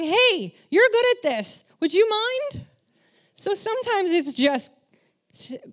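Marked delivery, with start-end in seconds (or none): 0.0-2.7 much higher, sugary asking; sweet request. Retold or imitated. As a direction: ask sweetly, flattering them. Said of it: "by just saying".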